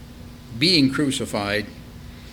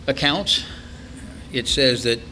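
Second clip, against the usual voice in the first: usual speaking voice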